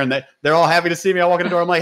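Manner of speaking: normal talking voice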